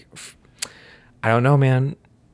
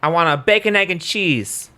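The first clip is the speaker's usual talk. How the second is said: louder, higher New York deli order; mock New York accent; 5 semitones higher, 3 dB louder than usual. Shown as a demonstration. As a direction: order a bacon egg and cheese like a New Yorker. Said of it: "Try a New York accent".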